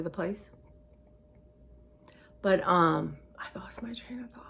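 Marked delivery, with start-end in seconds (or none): 3.4-4.4 breathy whisper, trailing off; a whispered aside to viewers. Real, not acted. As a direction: whisper it, lost and distracted. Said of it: "I have no idea what I was gonna say".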